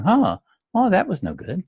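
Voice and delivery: light, high, airy surprise; light observer. Shown as a demonstration. Golfer watching from across the fairway, lightly amused. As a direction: shrug it off like an onlooker. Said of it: "Do you hear the difference in my voice".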